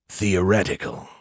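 toneless computer query; robotic voice. A narrator's reading. Elder AI demands an explanation, robotic. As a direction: demand it as an even, mechanical voice. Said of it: "came a robotic voice".

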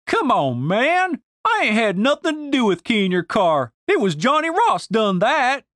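high-pitched Southern protest; indignant, drawling denial; defensive Denise. Acted. Narrator voices Denise protesting.

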